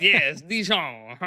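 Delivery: slow, drawn-out mock French; French accent. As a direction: stretch it out in a hammed-up French accent. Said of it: "Sounding like Lu-, Lumiere".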